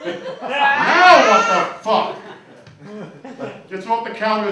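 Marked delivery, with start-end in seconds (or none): 0.1-2.1 exasperated yell. Retold or imitated. Camel drops again.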